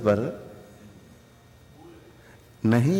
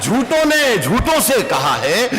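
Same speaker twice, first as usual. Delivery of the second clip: high, lilting recital; poetry recitation; 9 semitones higher than usual. Acted. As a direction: sing out the verse to the audience.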